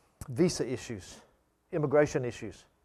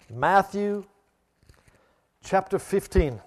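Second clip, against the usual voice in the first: loud, ringing sermon delivery; preaching at full force; 4 semitones higher, 9 dB louder than usual. Acted. Preacher rousing the congregation about faith, loud and ringing.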